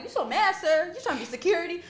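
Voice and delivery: high teasing mock question. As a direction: playfully rib the old man. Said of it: "I'm like".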